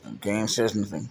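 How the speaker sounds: normal talking voice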